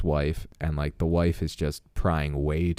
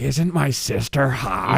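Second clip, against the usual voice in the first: slow, higher, leering sales pitch; sleazy brother voice; 6 semitones higher, slower than usual. Retold or imitated. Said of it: "He's like".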